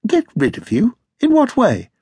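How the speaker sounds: high-pitched, innocent questioning; feigned innocence. A narrator's reading. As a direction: play innocent, as if puzzled by the accusation. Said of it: "Don't you come the innocent with me".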